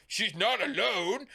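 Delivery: high, slow put-on declaration; exaggerated sing-out of a corny line; mock heroic. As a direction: draw it out, mocking the film's big moment. Mocks heroic line from film scene.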